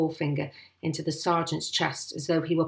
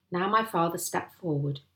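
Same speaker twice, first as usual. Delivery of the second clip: ordinary delivery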